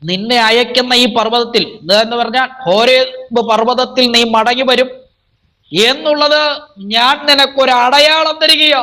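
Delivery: high, declaiming sermon voice; fervent preacher's declamation; full-force preaching. Acted. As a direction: proclaim it to the congregation on a high, sustained pitch.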